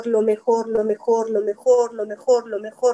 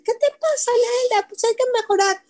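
much higher, put-on voice of the critics; mocking know-it-all; 10 semitones higher, faster than usual. People telling the speaker to keep improving, in a higher mocking voice. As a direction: scoff at the teacher in a higher mock voice. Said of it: "van a decir".

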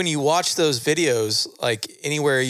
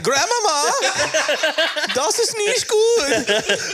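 put-on much higher, slow German kid whine; German accent; much slower than usual. Grandson to German grandma, high whiny German accent. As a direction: whine it in German like a small child. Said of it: "That's a pretty good German".

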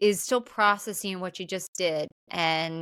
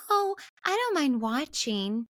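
higher, sweet little voice; imitating a shy, sweet girl; soft and sweet; 6 semitones higher than usual. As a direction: say it sweetly, pitched up. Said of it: "very softly and sweetly says".

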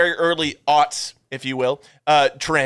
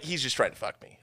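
flat muttered aside; 3 semitones lower, 11 dB quieter than usual. Retold or imitated. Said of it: "the girl would just be like".

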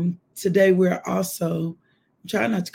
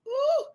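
high "woo" at normal volume; spontaneous praise cry; praise whoop. Real, not acted. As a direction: let a short high whoop slip out. Praise outburst during prayer.

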